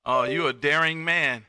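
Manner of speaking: ordinary delivery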